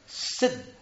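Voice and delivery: सिद्ध whispered with the द doubled; सिद्ध, breathy and high, द held; demonstrated pronunciation. Shown as a demonstration. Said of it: "ये है उसका उच्चारण". (सिद्ध का फुसफुसाया उच्चारण)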